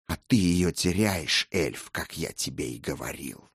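soft, low menacing vampire. Acted. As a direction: speak as a cold, scornful vampire. Vampire voiced by narrator.

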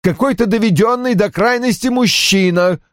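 high-pitched, anguished indignation; distraught outburst. A narrator's reading. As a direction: voice an outraged husband on the verge of tears. Разгневанный, отчаявшийся Рой перед рыданиями. (возмущение на грани слёз, высоким голосом)

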